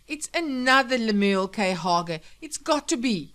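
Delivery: loud, high-pitched pleading; desperate. Acted. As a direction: insist frantically that it's a mistake. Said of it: "said the man desperately".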